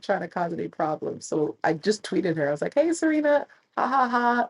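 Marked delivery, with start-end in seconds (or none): 2.7-4.5 high, louder bubbly greeting; cheery tweet. Retold or imitated. Tweeting Serena a bubbly hello, high and louder. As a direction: chirp it like a fan tweet.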